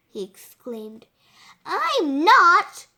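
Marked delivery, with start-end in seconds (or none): 1.6-2.9 loud, high protest; insistent denial. A narrator's reading. Girl denying she's a goody-goody, loudly insistent. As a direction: insist hotly, punching it out. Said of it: "she insisted".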